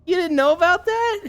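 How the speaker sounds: high, amazed question; giggling disbelief. Retold or imitated. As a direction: squeak it out in delighted shock. Housemates giggling at student.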